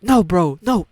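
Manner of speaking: high, loud imitation of someone snapping; retold outburst of upset refusal; snapped. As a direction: burst out in protest, acting it out. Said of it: "something snapped and he was like".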